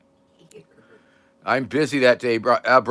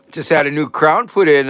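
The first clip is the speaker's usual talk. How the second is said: ordinary talk, nothing marked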